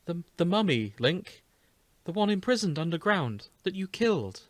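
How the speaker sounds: Zelda, incredulous, explaining. Acted. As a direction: explain it to Link in a high voice. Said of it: "Zelda voice".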